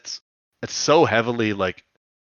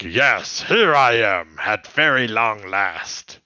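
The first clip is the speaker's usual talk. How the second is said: theatrical announcement; slower than usual. Retold or imitated. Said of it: "And he's like".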